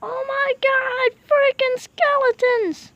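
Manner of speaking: high, slow alarmed cry. Real, not acted. Spotting skeletons at night, alarmed cry. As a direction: drag it out in fright.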